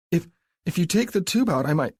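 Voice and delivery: high, stammering, anxious bargaining; flustered plea. Acted. Abby nervously bargains with Mark, stammering. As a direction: trips over her words, desperate.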